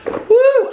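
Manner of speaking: high loud emotional whoop; shaky woo, moved by her own book. Real, not acted. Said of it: "I'm getting emotional".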